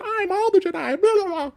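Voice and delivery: high, breathy mock Jedi line; mock her big moment in a high voice; mocking Rey.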